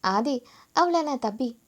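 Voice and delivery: ordinary delivery, nothing marked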